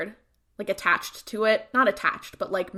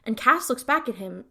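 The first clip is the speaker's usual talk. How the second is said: usual speaking voice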